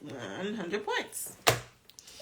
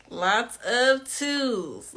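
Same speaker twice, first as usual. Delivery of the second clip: very slow, stretched-out repeat; drawn out; 2 semitones higher, much slower than usual. Real, not acted. Host reading players' answers, slowly drawn out. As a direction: drag each word out long.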